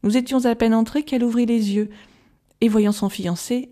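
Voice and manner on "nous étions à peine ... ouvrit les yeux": ordinary delivery, nothing marked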